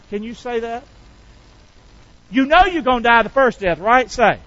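usual speaking voice

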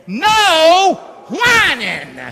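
loud laughing call for the crowd to shout; rally the congregation, big and loud; shouted slogan. Real, not acted. Pastor leads church in shouting the slogan, loud. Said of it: "say it with me".

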